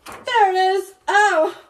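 high, loud exclamation on spotting it; excited find. Real, not acted. Vlogger finding the lost book cover, excited.

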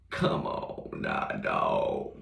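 deep slow mock voice. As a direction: drop way down low and drag it out slowly. Repeats line in dropped voice.

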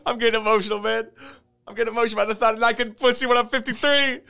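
high, mock-tearful wail; imitated sham crying, speeding up; fake crying. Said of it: "like, pretending to cry".

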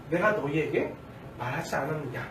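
a touch of annoyance, toned down; mildly irritated. Acted. Jesus reminding the people, slightly annoyed but softened. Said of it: "처음보다는 조금 누그러졌지만 그래도 약간 짜증이".

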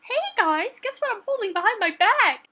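high, eager character voice; eager offer. Acted. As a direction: pipe up high, teasing a surprise. Excited character offering a treat.

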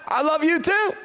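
high-pitched mock declaration; lightly hammed-up answer to an admirer; acted love reply. Traveler answering the love-struck ticket lady, high mock declaration.

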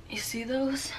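soft whispered aside; hushed check-in; whispered question. Real, not acted. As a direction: whisper it like a secret to viewers.

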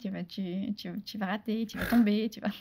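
a hint of a soft, nagging inner voice; little inner demon. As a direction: mutter the doubts like a demon on your shoulder. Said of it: "ce petit démon sur l'épaule qui te dit".

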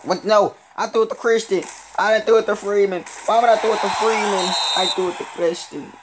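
loud, high-pitched, indignant complaint; really worked up, incredulous and loud; worked-up protest. Real, not acted. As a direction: protest the bad pass at full voice. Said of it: "I'm doing too much".

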